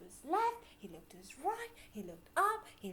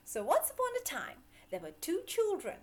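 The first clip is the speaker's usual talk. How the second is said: excited storyteller; 6 semitones higher, 7 dB louder, much faster than usual. A narrator's reading. Storytelling opener.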